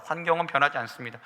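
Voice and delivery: ordinary talk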